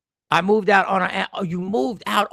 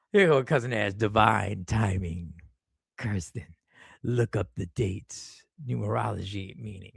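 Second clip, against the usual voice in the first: mock mystic tone; 9 semitones lower, 5 dB quieter than usual. Mock mystic reading of comment.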